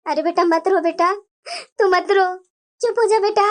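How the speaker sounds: lightly voiced mother. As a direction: hush her gently like a mum.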